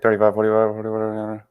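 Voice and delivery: quick auctioneer patter. Retold or imitated. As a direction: rattle off bids like an auctioneer. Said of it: "like, the auctioneer voice".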